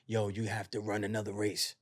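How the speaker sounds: low, soft, calm command; voice from within. Retold or imitated. Voice tells the prisoner, low and hushed. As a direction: tell them quietly, as a voice from within. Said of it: "you hear a voice".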